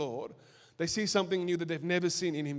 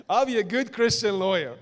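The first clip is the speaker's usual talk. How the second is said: laughing; 3 semitones higher than usual. Retold or imitated. Mocking his own naive prayer.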